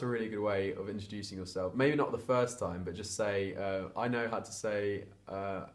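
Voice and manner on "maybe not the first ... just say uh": usual speaking voice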